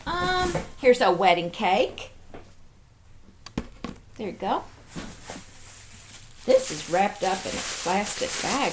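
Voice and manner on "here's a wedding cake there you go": normal talking voice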